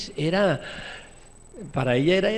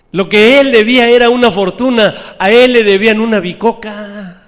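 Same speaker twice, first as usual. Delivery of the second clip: loud, higher scripture proclamation; preaching at full force; 5 semitones higher, 11 dB louder than usual. Acted. Preacher reading the parable aloud to the church, loud preaching.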